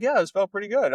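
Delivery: a hint of an offhand client; casual client. Client answering the coach, mock casual. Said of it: "And they're like".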